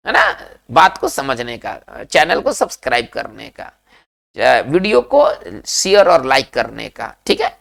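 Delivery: ordinary delivery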